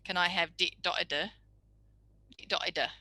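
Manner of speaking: "di-, dotida" with hard t and d in place of every s; child stopping all fricatives, "dotida". Shown as a demonstration. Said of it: "the child that says".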